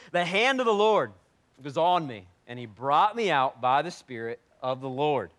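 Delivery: loud, proclaiming Bible reading; preacher reading scripture with force. Acted. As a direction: announce the verse to the congregation. Reads scripture aloud.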